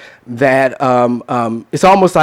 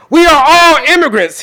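mock proud claim; 13 semitones higher, 3 dB louder than usual. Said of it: "he says to me".

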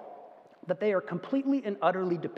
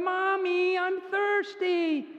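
put-on child calling at night; 9 semitones higher, much slower than usual. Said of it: "you hear from their room".

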